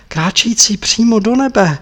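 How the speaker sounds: kráčející přímo do nebe sung out high in joy; rapturous. Acted. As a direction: lift the phrase up like praise. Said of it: "šeptal Tepaga radostí unesen".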